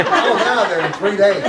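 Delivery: loud, laughing through the line; cracking up at the memory. Real, not acted. Laughing at his own story.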